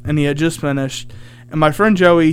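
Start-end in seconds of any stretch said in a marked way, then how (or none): none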